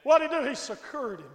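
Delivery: ordinary talk, nothing marked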